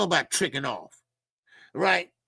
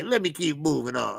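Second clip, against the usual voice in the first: normal talking voice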